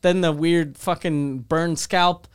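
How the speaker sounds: usual speaking voice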